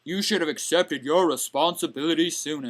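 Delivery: low, hushed put-on teacher's voice; stern teacher. Teacher refusing a student who asks for help, stern and low.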